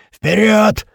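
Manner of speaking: high, loud battle roar; bison-like roar. A narrator's reading. Big gunner leading the charge, loud roar. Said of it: "ревёт бизоном Большой".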